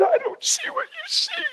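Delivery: very high, soft, slow whimper; sobbing son. Retold or imitated. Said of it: "sobbed like a baby and said".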